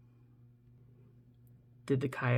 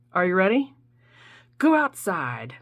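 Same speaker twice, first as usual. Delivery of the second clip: ordinary talk, nothing marked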